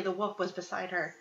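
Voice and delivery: ordinary delivery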